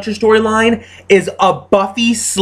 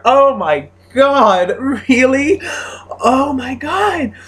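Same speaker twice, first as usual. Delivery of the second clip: slow, high, drawn-out groan of disbelief; weary incredulous oh my god; exasperated; 7 semitones higher, much slower than usual. Real, not acted. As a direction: let it drag out in disbelief. Exasperated at plot.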